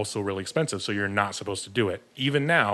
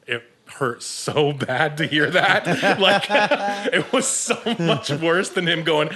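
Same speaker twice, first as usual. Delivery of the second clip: pitched-up laughter breaking through the words; laughing; 8 semitones higher, 6 dB louder, slower than usual. Real, not acted. Telling podcast hosts about a painful first week, laughing high and loud. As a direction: laugh it out in pained disbelief.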